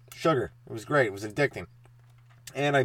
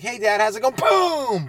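mock cheerful kid; 10 semitones higher, 5 dB louder, slower than usual. Kid greets dad, gets hit.

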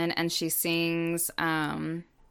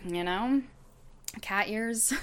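ordinary talk, nothing marked

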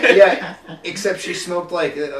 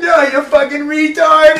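much higher, louder, slowed shout; mock shouting coworker; 10 semitones higher, 12 dB louder, slower than usual. Lifer coworker shouting at the trainee, mock shout. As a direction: bark at the dumb trainee. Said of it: "she'd be like".

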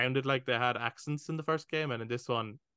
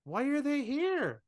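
baffled disbelief; 10 semitones higher than usual. Retold or imitated. Gamer retelling confused reaction, high baffled disbelief. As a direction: play at baffled outrage. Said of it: "and I went".

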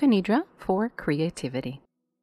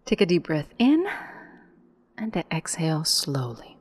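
guided meditation. Acted. Guide leading listeners into yoga nidra, calm meditation voice. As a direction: lead the breath in on a rise, let the exhale fall away.

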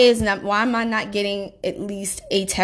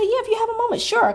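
sweetly mock-polite. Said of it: "And I said".

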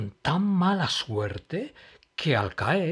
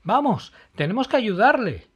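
high, loud urgent call; rally the friends to help; 10 semitones higher, 8 dB louder than usual. Acted.

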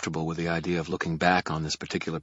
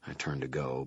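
plain reading voice

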